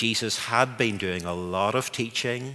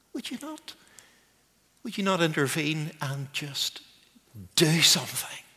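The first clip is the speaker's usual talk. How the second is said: quiet pleading prayer; 7 semitones higher, 3 dB quieter than usual. Retold or imitated. His own pleading prayer.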